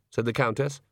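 ordinary delivery

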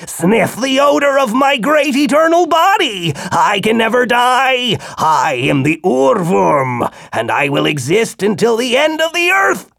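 high booming creature declaring its immortality; boastful worm. A narrator's reading. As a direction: boast of eternal life as the ancient worm. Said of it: "the worm declared shrilly".

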